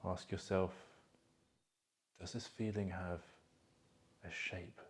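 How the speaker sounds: soft, calm meditation voice with long pauses; guided meditation. Acted. Coach guiding listeners to feel emotions in their body, guided meditation. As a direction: guide them gently inward, leaving space between phrases.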